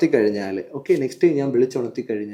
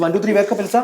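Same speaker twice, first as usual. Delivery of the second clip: quick, high-pitched rousing call; hypnotist's sudden raised-voice wake-up; wake-up command; 7 semitones higher than usual. Real, not acted. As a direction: call out the count to snap someone out of trance. Actual wake-up command to subject.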